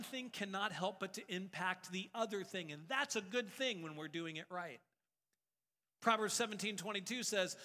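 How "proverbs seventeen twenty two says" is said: normal talking voice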